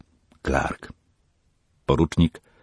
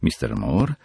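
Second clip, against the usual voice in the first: ordinary delivery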